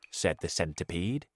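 usual speaking voice

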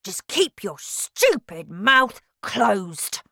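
slow, gruff angry father. A narrator's reading. Father scolding Matilda, slow and angry. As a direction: spell out each word in anger. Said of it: "said her father angrily".

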